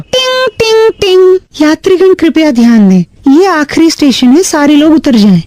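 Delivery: chimed jingle, then a formal passenger announcement; metro announcer. Pretend. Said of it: "announcement वाली".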